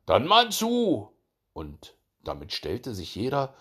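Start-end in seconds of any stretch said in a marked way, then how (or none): 0.1-1.1 high, loud, drawn-out go-ahead; rousing slow cry to begin; starting call. A narrator's reading. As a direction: call out to get going. Signal to begin the race.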